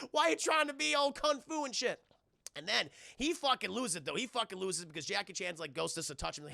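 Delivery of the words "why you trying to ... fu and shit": high-pitched incredulous teasing; comic, high-voiced roast; mock ridicule. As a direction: imitate someone calling out a pretender. Retold Tucker tearing into Cheadle.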